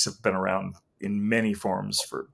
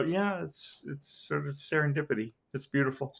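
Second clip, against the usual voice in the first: gentle wonder; 4 semitones higher, 6 dB quieter than usual. Retold or imitated. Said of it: "let it go and go".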